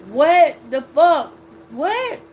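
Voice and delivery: loud, slow, stunned "what the fuck", then a high squeak on "What?"; shocked disbelief. Retold or imitated. Telling a friend how she reacted to the psychic, stunned and disbelieving. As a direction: react like you can't believe your ears.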